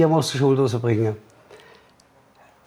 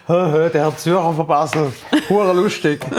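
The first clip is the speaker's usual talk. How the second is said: mocking laugh; 3 semitones higher than usual. Mocks the name, thinking it a joke.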